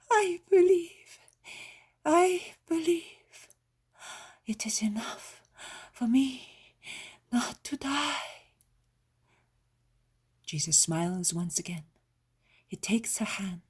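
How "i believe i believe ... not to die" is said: high, slow, louder tearful declaration of faith; cling to faith, voice breaking; fervent belief. A narrator's reading. Dying old woman tearfully declaring her faith to Jesus.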